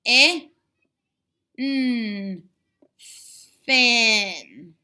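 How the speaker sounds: "I, n, thin" said sound by sound, stretched, then blended into "thin"; sounding out slowly. Shown as a demonstration. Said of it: "Let's sound it out".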